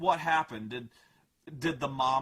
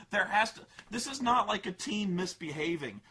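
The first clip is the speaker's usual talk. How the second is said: ordinary talk, nothing marked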